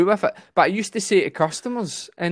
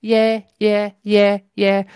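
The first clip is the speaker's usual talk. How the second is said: little nephew agreeing; 6 semitones higher, much slower than usual. Retold or imitated. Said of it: "And he was like".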